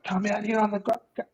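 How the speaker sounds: high, put-on voice of a shirtless junkie; retold dare. Junkie taking his shirt off and daring him to fight, high put-on voice. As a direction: call him out like a scrappy junkie.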